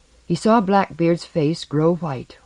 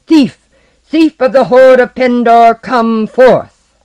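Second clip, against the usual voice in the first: summoning shout, high and loud; 9 semitones higher, 13 dB louder, slower than usual. Acted. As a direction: bellow at the cave like a sorcerer. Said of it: "as he shouted".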